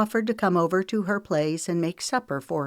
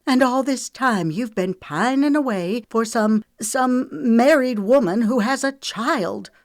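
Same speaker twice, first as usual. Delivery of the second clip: angry, high, loud accusation; jealous woman accusing the man she loved; growing louder; 7 semitones higher, 6 dB louder than usual. Acted. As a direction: build the accusation louder to the end. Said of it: "Her voice grew louder and her nostrils began to flare".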